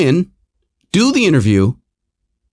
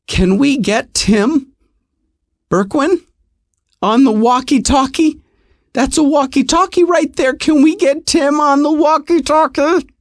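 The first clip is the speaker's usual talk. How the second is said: high, sweet, overly polite ask; sugar-coat the request to the attendant; nicest voice; 9 semitones higher than usual. Retold or imitated. Asking the attendant in a high, sickly sweet voice. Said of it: "in my nicest voice".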